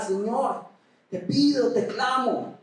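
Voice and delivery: high, urgent plea; intense prayer. Shown as a demonstration. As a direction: Hannah crying out to God in the temple. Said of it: "orando con intensidad". (súplica intensa y aguda)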